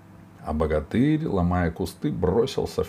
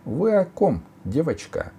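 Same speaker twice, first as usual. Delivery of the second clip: lightly voiced courteous chieftain; higher-pitched, politely puzzled asking; polite question; 10 semitones higher than usual. A narrator's reading. Robber chief feigning ignorance to the girl, polite question. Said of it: "вежливо спросил атаман".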